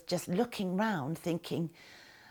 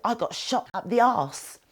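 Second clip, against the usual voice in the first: higher and louder, a booming Marine shout; loud booming punchline; 5 semitones higher, 7 dB louder than usual. Retold or imitated. Marine teases the amputee across the ward, booming loud. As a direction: bellow it across the ward for a laugh. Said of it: "said in a really loud, booming voice".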